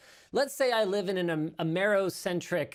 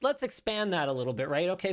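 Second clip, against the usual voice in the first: ordinary talk, nothing marked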